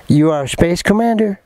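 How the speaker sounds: put-on English accent, badly faked. Englishwoman insisting to him during a break, imitated English accent. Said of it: "Avec l'accent anglais".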